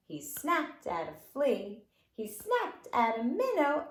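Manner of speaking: lilting sing-song rhyme voice; fingerplay rhyme for kids; children's rhyme recitation. Acted. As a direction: recite it playfully for toddlers.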